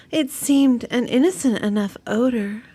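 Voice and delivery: ordinary talk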